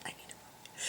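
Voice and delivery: imitating husband's whisper, fading almost to nothing; mock queasy whisper, dying away. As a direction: breathe it out like you're about to be sick. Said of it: "he's totally just like".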